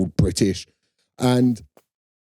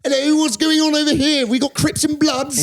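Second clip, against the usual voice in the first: high, bright put-on comedian voice, much higher; hammed-up impression of a TV presenter; Michael McIntyre impression. Mocking the documentary host's presenter voice. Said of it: "I've got a good McIntyre".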